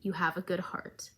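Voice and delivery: soft, hushed, comforting whisper; calm someone fading out, barely voiced; soothing whisper. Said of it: "And she goes".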